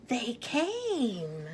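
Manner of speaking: he can't believe they came, let it pop out; excited. Acted. Mouse sees villagers arrive.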